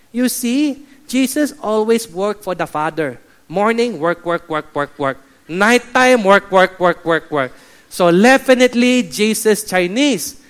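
mock Chinese accent with chanted repeats; Chinese pastor. Chinese pastor explaining to the others, mock accent. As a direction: argue the case in a comic Chinese accent. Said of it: "Then the Chinese pastor said".

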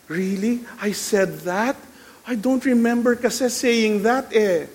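put-on wide-eyed denial; playing innocent. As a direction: feign not remembering a thing. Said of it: "when you play innocent".

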